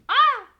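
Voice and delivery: sudden loud high yelp; real startled scream at falling shelf items; startled shriek. Real, not acted. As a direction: shriek as something falls. Things falling off her shelf.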